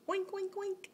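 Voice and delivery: high little oinks in a row; pig oinking. Shown as a demonstration. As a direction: imitate a piggy for a toddler. Answers what the pig says.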